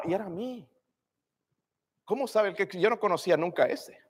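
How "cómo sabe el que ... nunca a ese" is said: normal talking voice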